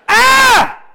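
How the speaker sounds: a high, loud burst of a yell; sharp, high, loud yelp; sudden shout. Real, not acted. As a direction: let out a sharp cry. Sudden outburst mid-sermon.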